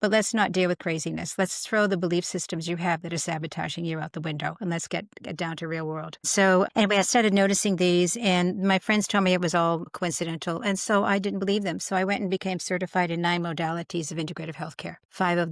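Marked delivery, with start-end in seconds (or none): none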